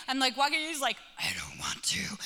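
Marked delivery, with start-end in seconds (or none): 1.2-2.2 strained, voiceless mock tantrum; harsh whispered whine of an angry child; child losing it. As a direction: imitate a kid starting to lose it through a tight throat. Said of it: "he starts to, like, lose it".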